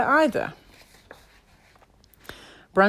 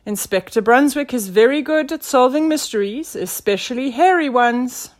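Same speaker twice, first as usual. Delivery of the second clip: higher, louder character voice; proud sidekick; 6 semitones higher, 6 dB louder than usual. A narrator's reading. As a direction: announce the inspector's talents. Nelson praises the inspector aloud.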